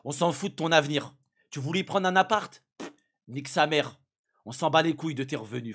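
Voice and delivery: loud, careless mock taunt; mocking dismissive. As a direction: brush it off with scorn. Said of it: "Yanis, il a dit".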